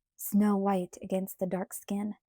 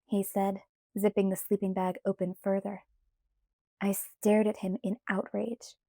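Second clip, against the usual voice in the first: ordinary delivery, nothing marked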